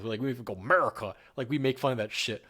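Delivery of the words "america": loud, mocking American-pride cry; mock patriotic shout of "America.". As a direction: make fun of a proud American. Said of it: "we make fun of it up here".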